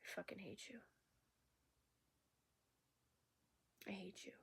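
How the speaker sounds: a seething whisper of hate; hushed hate. Real, not acted. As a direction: whisper the hatred through clenched anger. Venting hatred in a hushed voice.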